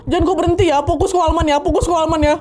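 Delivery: high-pitched, insistent reenacted plea; anxious urging. Passenger urging the rider to stay focused, anxious. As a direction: press the point in a high, worried voice.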